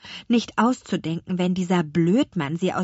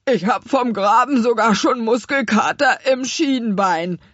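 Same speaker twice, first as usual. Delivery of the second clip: louder, whiny little apple man; tired digger whining to his friends; complaining; 5 semitones higher, 8 dB louder than usual. Acted. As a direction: moan about the sore shin. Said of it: "klagte Karottenwams".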